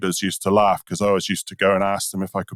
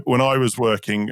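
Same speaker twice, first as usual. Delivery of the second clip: normal talking voice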